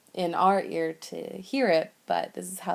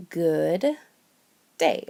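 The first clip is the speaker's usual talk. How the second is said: usual speaking voice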